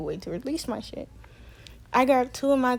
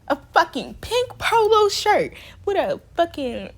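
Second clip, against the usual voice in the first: exasperated; 7 semitones higher, 5 dB louder than usual. Real, not acted. Irritated description of the staffer.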